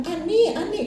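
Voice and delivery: high calling out to the swing. Retold or imitated. Grandmother calling to her granddaughter on the swing, high call. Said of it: "Itu semakin saya teriak".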